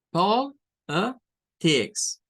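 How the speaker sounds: "Politics" drawn out as "pol-ə-tics", x0.3 slow; slow Politics with schwa. Shown as a demonstration. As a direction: stretch "Politics", schwa in the middle. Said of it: "se queda en medio". ("Politics" muy lento, "pó-lə-tics", con schwa en medio)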